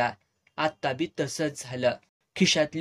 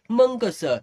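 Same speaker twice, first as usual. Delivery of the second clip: sharp retort; 7 semitones higher, 5 dB louder, slower than usual. Retold or imitated. Heated argument over money.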